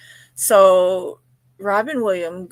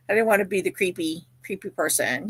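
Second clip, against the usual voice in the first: ordinary talk, nothing marked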